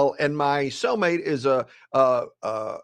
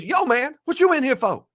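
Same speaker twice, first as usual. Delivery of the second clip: cellmate's voice; 8 semitones higher than usual. Retold or imitated. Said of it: "he says".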